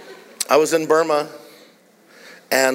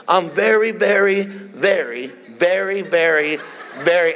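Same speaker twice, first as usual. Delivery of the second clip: each "very" pitched up and pressed separately; emphatic repeated very; 3 semitones higher, slower than usual. Retold or imitated. Preacher testing his translator, "very" repeated in emphatic pulses. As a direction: pile up "very" with heavy, deliberate emphasis. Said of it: "So the stronger, the longer".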